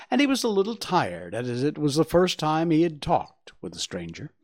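ordinary delivery, nothing marked

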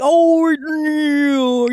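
mock simple American. Mimicking a TV actor's simple American, dopey accent. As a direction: stretch it into a simple-minded American drawl. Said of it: "just, like, a simple American".

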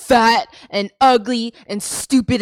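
loud, slow mock tirade; hammed-up angry shouting; hostile insults. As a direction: hurl each insult like a spurned man. Imitating rejected men hurling insults.

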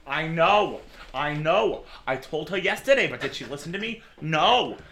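loud, emphatic receptionist on the phone; exasperated desk-clerk chatter, punching words; gossiping receptionist. Acted. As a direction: complain to a friend down the phone. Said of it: "she has the phone to her ear".

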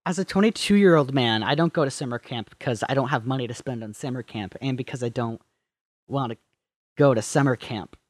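low quiet deadpan. Pretend. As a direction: say it dry and low. Deadpan mock reply.

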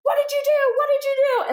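high-pitched imitation of an accusing doctor; mock yelling. Doctor yelling at the new therapist, mock outrage. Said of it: "he started yelling at me".

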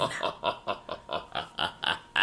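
soft, breathy, echoing evil laugh; sinister villain chuckle. Acted. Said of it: "That was Shang Tsung".

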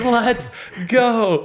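high, drawn-out victory cry; triumphant cheer. Retold or imitated. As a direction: re-enact crowing from up in the air. Retells cheer while lifted like Titanic.

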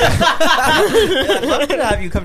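slow, loud, high talk through laughter; helpless laughter breaking into the words; laughing while talking. Real, not acted. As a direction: crack up through the whole line. Cracking up while teasing.

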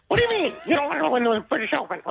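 high squeaky golfer voice; Jack Nicklaus. Retold or imitated. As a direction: squeak it out, outraged. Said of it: "high-pitched, squeaky voice Jack Nicklaus".

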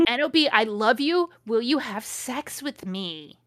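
hammed-up proposal, slowing at the end; measured dramatic reading. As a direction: read it out deliberately, drawing out the question. Rereads the declaration more measuredly.

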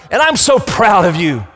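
high, loud and proud declaration; the Father proclaiming. Acted. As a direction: announce it from heaven with pride. God's voice declaring pride.

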